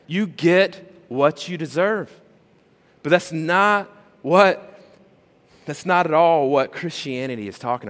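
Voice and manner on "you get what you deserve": ordinary delivery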